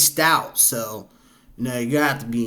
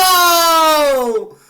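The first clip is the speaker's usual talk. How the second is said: shocked cry; 13 semitones higher, 6 dB louder than usual. Real, not acted. Watching a man fall off.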